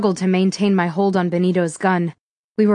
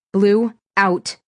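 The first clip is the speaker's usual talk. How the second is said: mildly raised command; yelled; 3 dB louder than usual. A narrator's reading. Handler to the dog mid-struggle, restrained yell. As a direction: call the dog off firmly. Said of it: "I yelled as I felt".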